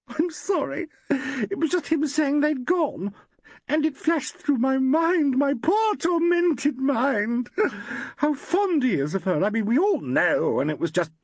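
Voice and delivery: high, slow, teary voice; a distraught actress explaining herself; tearful young woman. A narrator's reading. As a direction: gush out the worry near tears. Tearful Valerie pours out fears.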